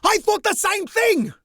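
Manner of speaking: high, loud eager shout; shouting interruption. Acted. Mirthafan interrupting the instructor, high loud shout. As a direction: burst in with a shout. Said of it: "Mirthafan interrupts".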